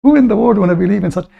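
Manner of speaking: high, fast scoffing question; mocking disbelief. Real, not acted. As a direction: scoff at the idea, quickly.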